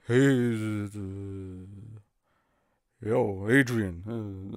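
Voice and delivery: a lightly voiced Stallone, slow and drawn out; Stallone impression. Streamer asked by chat for Stallone, slow Stallone drawl. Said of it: "a Stallone talking to Nick Cage impression".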